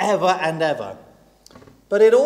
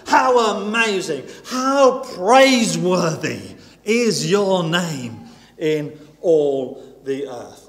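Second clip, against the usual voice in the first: rising fervent praise, louder toward the climax; preaching praise; 4 dB louder than usual. Acted. Preacher voicing the psalm to the congregation, building in fervent praise.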